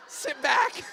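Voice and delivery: high, loud burst into laughter; laughing. Real, not acted. Storyteller admitting he did nothing, laughing out loud. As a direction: crack up as you shout it. Laughing at his own cowardice.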